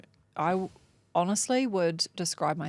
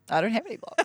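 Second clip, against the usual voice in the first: laughing denial; 5 semitones higher, much faster than usual. Retold or imitated. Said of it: "And I said".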